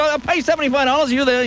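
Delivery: high put-on voice of the cruise director. Cruise director offering seventy-five dollars, in a high mock voice. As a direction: toss out the offer like a cheap showman. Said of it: "you know, like that".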